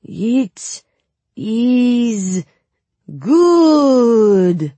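high, loud, hammed-up Suli accent; outrageous Suli accent. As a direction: overdo a fake Suli accent. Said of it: "in the most outrageous Suli accent".

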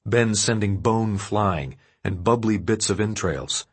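ordinary delivery, nothing marked

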